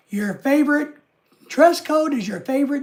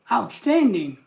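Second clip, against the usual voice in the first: ordinary talk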